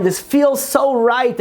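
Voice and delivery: high, slow, mildly tearful quote; retold crying. Old man overcome after wearing tefillin, imitated crying. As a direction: raise the pitch and draw it out, imitating tearful relief. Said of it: "he starts crying".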